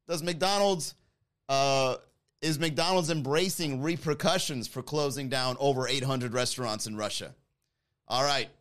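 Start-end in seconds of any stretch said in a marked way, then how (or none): none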